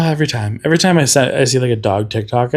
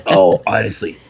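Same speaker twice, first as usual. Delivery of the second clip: normal talking voice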